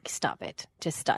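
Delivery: low whispered bossy order; whispered command. Retold or imitated. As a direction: hiss it like a bossy child. Daughter bossing her mother.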